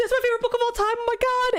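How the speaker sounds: high, thrilled gush over a favorite book; excited squeal. Retold or imitated. Retelling her reaction to the teacher, squealing with excitement. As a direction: squeal it like a delighted fan. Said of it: "And I was like".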